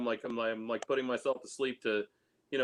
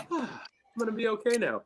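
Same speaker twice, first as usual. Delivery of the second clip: relieved sigh; 10 semitones higher than usual. Retold or imitated. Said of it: "You're just like".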